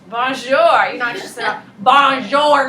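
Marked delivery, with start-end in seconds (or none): 0.1-0.9 loud attempt at French; attempted French accent. Shown as a demonstration. Teacher showing French phonemes, attempted French accent. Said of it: "I can't do it in French". 1.8-2.7 "Bonjour" loud with no French accent. Shown as a demonstration. Teacher showing what's not allowed, loud un-French accent. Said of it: "You're not allowed to say it like that".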